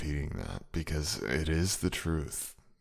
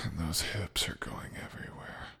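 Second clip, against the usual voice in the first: whispering; 6 dB quieter than usual. Acted.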